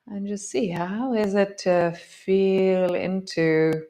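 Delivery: slow, drawn-out inviting voice; guided meditation. Acted. Teacher inviting students to feel the pause, slow meditation voice. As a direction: draw them gently into the pause.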